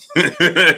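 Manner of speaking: high, loud burst of laughter; quick high laughing fit; real laugh. Real, not acted. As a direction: break into a real cackle. Laughs at mother's reaction.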